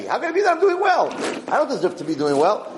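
a hint of an awed believer; mock wonder. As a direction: marvel at being well. Acted amazed believer.

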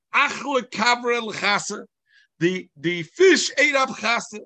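slow, loud, high declaration under oath; Rav Nachman swearing the fish ate Chasa, slow and loud; emphatic sworn cry. Retold or imitated. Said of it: "ich schwör sich".

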